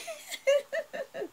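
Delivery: squealing laugh up high. Real, not acted. Vlogger laughing at her own complaint to fans. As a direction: let a high laugh burst out.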